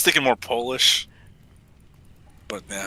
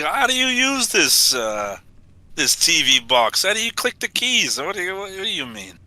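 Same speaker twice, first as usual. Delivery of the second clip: put-on Russian accent; 6 semitones higher than usual. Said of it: "What's with the Russian accent?".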